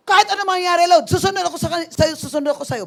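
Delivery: loud, high declaration of faith, easing at the end; angry vow. Retold or imitated. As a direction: shout your vow to the Lord in anger. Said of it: "yung galit na galit ka".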